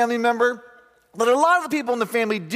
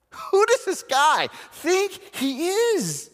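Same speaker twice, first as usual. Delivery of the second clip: slow, high incredulous jab; a hammed-up who-does-he-think-he-is; mock indignation; 9 semitones higher, much slower than usual. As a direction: scoff at his nerve. Preacher mocking Peter.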